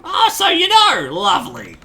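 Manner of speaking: put-on flustered old granny caught with a messy house; old lady voice. Ma greeting her son's surprise guest in a flustered old lady voice.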